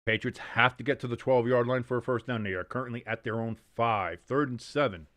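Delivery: play-by-play. Acted. Play-by-play call of the game.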